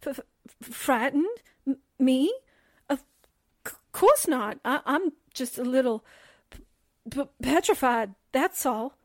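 petrified, halting and stuttering; frightened stammer. Acted. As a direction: stutter on the first sounds. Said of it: "I think you're frightened".

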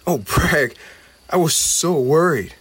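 relieved cry. Acted. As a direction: pour out the worry in relief. Goblin greets rescued friend.

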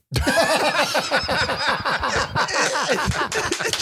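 high, loud burst of laughter; cracking up. Real, not acted. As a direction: laugh helplessly at the memory. Cracks up at the story.

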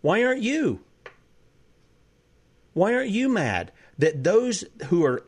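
rising, heated indignation; mad. Real, not acted. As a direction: let the anger climb on "why aren't you". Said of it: "you sound mad, [name]".

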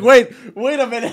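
high, loud, laughing interruption; imitated baffled reaction. Co-host mimicking friend's reaction to the story, mock baffled. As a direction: halt the talk in mock bewilderment. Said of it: "I like your reaction".

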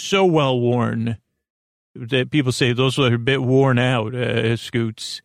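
ordinary talk, nothing marked

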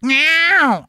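squeaky cat meow, high and loud; a playful, high meow; high mock meow. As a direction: tease it out like a cat. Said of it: "he's like".

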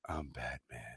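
low, hushed, sorrowful Batman; sad Robert Pattinson Batman. Acted. Showing the co-host a Batman voice, so sad. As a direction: sink down soft and sad. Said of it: "Robert Pattinson is so sad".

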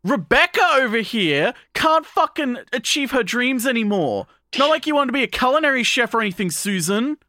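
mock her like a bratty bully; mocking sarcasm. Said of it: "being like".

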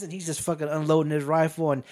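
ordinary delivery

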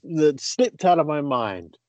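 ordinary delivery

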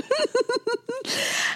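high breathy giggle; laugh. Real, not acted. Laughing after weird remark.

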